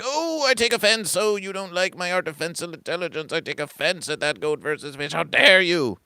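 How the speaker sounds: high-pitched indignant outburst, hammed up; mock offended. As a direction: act wounded, pitch up high. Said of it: "you take it personally".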